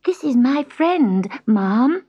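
high, nervous introduction; agitated cat. A narrator's reading. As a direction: introduce a friend anxiously. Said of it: "said Gobelino in some agitation".

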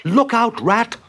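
high-pitched panicky shout; alarmed warning. Acted. Mole warning Rat before the crash, alarmed. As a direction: cry out a sudden warning.

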